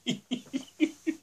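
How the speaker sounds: high, trailing giggle; soft, high-pitched laughter. Real, not acted. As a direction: laugh at your own joke. Laughs at his own joke.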